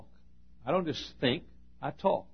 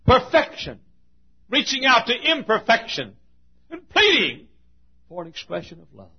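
impassioned preaching; 11 semitones higher, 13 dB louder than usual. Real, not acted. Preacher building up to the congregation about God's love. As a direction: preach it in rising waves.

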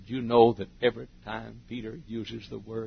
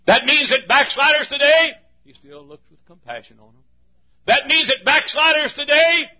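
full-force preaching; 7 semitones higher, 10 dB louder than usual. Acted. Preacher pleading for backsliders, loud and high. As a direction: preach in waves, loud then hushed.

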